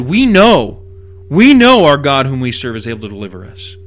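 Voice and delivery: WE KNOW driven home firmly and loudly, then steady conviction; emphatic, stressed WE KNOW; emphatic declaration. Retold or imitated. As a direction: declare "we know" with total certainty, punched hard. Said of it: "this is very emphatic".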